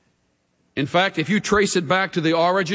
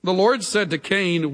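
ordinary talk

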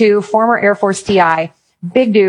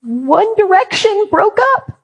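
put-on high, whiny teen girl voice; teen girls crying; 13 semitones higher, slower than usual. Students mourning their boy band, high wailing girl voice. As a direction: play a heartbroken teenage girl, pitched way up. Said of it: "all of the girls were sobbing".

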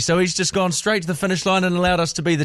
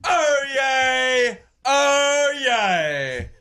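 drawn-out, high British crier's cry; British town crier; 5 semitones higher, much slower than usual. Pretend. Host trying out as town crier, fancy British accent. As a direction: proclaim it slowly in a put-on British accent. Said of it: "Why are you sounding British?".

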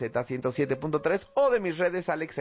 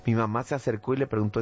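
plain reading voice

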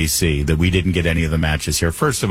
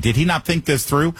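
ordinary talk

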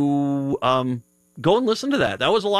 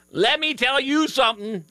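put-on a quick, half-hearted stab at a Staten Island accent; pitched-up try at a Staten Island mom; 9 semitones higher than usual. Host attempting a Staten Island mother at the airport, a brief half-hearted accent. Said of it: "I can't do the accent".

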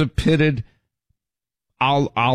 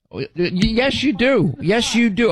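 insistent retort; 9 semitones higher, slower than usual. Real, not acted. Arguing with James on air.

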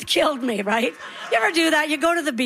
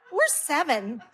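put-on meek child; 4 dB quieter, slower than usual. Small kids answer from back seat.

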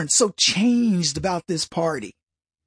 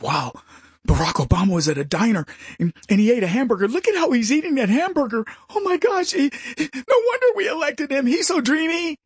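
high gushing mock swoon; mock swooning fans; 4 semitones higher, faster than usual. Host mocks fans gushing over Obama, in a high mock swoon. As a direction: gush like a starstruck fan. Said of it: "remember when he got elected".

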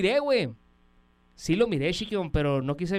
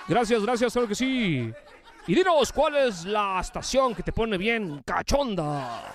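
raised, lively radio announcer delivery; radio DJ announcing; 5 semitones higher, slower than usual. Acted. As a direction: introduce it on air with drawn-out line endings. DJ prompts caller for station slogan.

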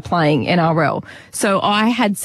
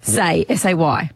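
ordinary talk, nothing marked

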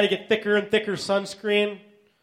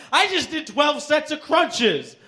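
high, drawn-out brag; mock bragging; 7 semitones higher, slower than usual. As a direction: boast like a show-off brother, slowly and raised in pitch. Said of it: "he's all bragging".

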